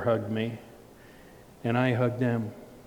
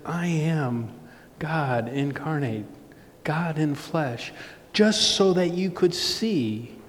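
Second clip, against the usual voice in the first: higher, slow, measured proclamation; emphatic preaching; 4 semitones higher, slower than usual. Real, not acted. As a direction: proclaim it phrase by phrase from the pulpit. Preaches Jesus' words.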